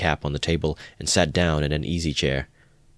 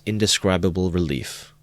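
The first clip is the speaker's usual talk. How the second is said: usual speaking voice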